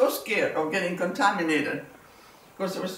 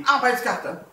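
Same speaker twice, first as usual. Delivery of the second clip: retold scream; 5 semitones higher, 4 dB louder, slower than usual. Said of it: "this guy is screaming at me".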